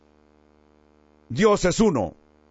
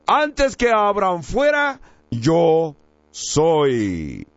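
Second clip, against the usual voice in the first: solemn declaration; much slower than usual. Retold or imitated. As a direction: quote it as a weighty pronouncement. Quotes Jesus' declaration with solemn weight.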